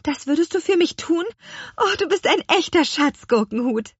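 put-on high excited girl witch; delighted little witch. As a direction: gush like a thrilled little witch. Little witch thanks her helper.

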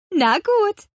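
high, sing-out agreement; calling out. A narrator's reading. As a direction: agree with a high little call. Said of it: "rief Petronella". (hoch gerufene Zustimmung)

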